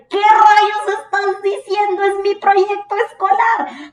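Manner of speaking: high, slow disbelieving cry; stunned inner outburst. Retold or imitated. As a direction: drag it out in pure disbelief. Inner shock at surprise announcement.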